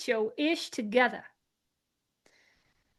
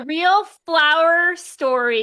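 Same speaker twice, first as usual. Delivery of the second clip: mock cheer; 5 semitones higher, 9 dB louder, slower than usual. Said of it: "you're gonna be like".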